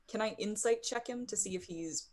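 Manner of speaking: usual speaking voice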